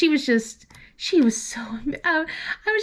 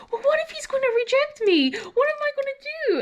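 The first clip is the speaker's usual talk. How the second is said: much higher, rushing anxious questions; worried daughter; faster than usual. Retold or imitated. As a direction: fret like a teen fearing rejection. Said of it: "And then she goes".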